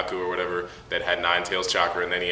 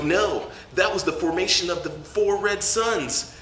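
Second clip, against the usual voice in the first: high, slow dramatic hero voice; Guy Sensei; 10 semitones higher than usual. Retold or imitated. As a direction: declaim it slowly with hammed-up grandeur.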